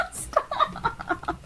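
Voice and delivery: high laughing stop; giggly plea; laughing protest. Real, not acted. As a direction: laugh out the word.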